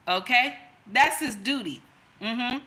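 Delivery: ordinary delivery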